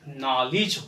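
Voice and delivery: "नॉलेज" as "naa-lij"; correct pronunciation. Shown as a demonstration. Teacher giving the right sound, "नॉलेज" as "naa-lij". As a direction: open first vowel, short i in "नॉलेज". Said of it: "हमारा sound नॉलेज होगा".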